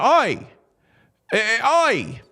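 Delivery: high-pitched mock Aussie "oi"; mock Australian accent. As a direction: call out a hammed-up Australian cry. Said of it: "don't wanna do a bad Australian accent".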